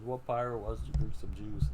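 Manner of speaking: put-on loud, sneering jeer at the kid; mock him loudly like a rowdy bandit; a loud mocking bandit taunting Luffy over juice.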